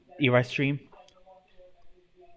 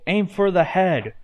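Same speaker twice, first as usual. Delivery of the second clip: high, raised command; yelled; 7 semitones higher, 4 dB louder than usual. A narrator's reading. Earthstream instructs the crafters fighting zombies, yelling. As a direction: yell it as an order in battle. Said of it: "he yelled".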